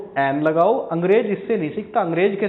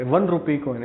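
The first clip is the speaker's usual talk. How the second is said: ordinary talk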